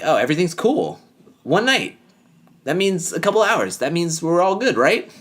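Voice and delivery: high, mocking imitation of breezy excuses. Viewer scolding a reality show man, mockingly breezy. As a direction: mimic him brushing it off, pitch climbing to a questioning "right?".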